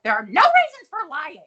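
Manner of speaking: high, loud indignant scolding; mock yelling. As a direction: imitate an outraged telling-off. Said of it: "Missus Garrett's yelling".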